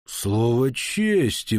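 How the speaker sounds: very slow, stretched-out words; drawled. A narrator's reading. Baron recognising the viscount, slow drawl. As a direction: draw each word right out. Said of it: "протянул он".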